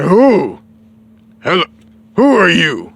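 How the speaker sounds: loud, booming big-king greeting. Retold or imitated. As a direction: boom it out at a stranger. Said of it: "come across the Ox King".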